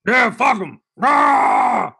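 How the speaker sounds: loud high yell into a T-Rex roar; growing mock war cheer ending in a roar; Ed Orgeron. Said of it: "there's an extended T-Rex cheer".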